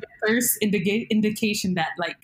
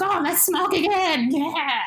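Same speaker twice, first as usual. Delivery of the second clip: high-pitched, drawn-out imitation of partiers; mock excited friend, slow and high; eager party cheer; 10 semitones higher, slower than usual. Friends urging another round of smoking, mock excited.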